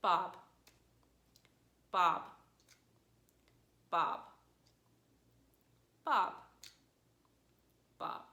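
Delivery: short "Bob" with no intonation; "Bob" as if calling without any meaning to convey; fifth tone. Shown as a demonstration. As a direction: say "Bob" briefly, flat and unexpressive. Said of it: "it's just a flat tone".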